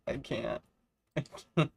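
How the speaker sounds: real giggles breaking up the words; overwhelmed laughter. Real, not acted. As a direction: plead helplessly while cracking up.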